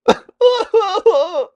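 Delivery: a big laugh out of nowhere; sudden loud high laughter. Real, not acted. Laughing at own story.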